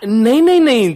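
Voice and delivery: loud, high, hurried protest; alarmed loud no. Acted. Father cutting off his wife, loud and alarmed. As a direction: blurt it out to stop her.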